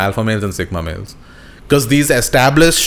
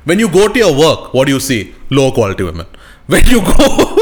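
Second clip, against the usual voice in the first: raised, punchy question, then a dropped answer; mock alpha lecturing; 4 dB louder than usual. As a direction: preach it like a hammed-up alpha guru. Plays alpha guru lecturing his friend.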